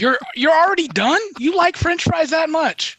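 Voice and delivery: high-pitched, surprised questioning; taken aback, pitch jumping up; incredulous. Real, not acted. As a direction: question them in amused disbelief. Teasing disbelief at friend giving up.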